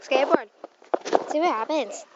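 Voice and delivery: talking while bouncing; jolted voice mid-bounce; on a trampoline. Real, not acted. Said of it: "I'm on the trampoline".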